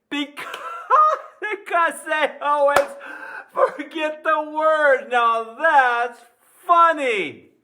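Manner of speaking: high, loud laughing through the words; cracking up. Real, not acted. Teacher tickled by his own joke, laughing loudly.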